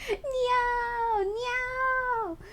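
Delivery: cute high kitten mews; cat meowing. Pretend. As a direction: meow like the little cat avatar. Plays the giant cat.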